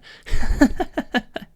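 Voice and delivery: breathy outward laughing burst. Shown as a demonstration. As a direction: laugh pushed out on the breath, high and loud. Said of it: "try the inward laugh instead".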